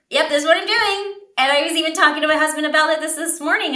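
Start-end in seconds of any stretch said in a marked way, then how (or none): 0.1-1.2 high, quick and casual; breezy confident. Retold or imitated. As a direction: toss it off, totally sure. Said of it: "I'm just like".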